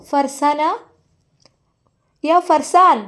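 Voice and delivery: high, loud call through a closed door. Acted. Bivi calls Farzana through the door, loud high call. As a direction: call her name from outside the door.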